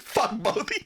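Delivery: high-pitched mock fury; hammed-up angry mother; mom's angry outburst. Mom curses both sons, repeated.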